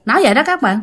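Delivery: ordinary delivery, nothing marked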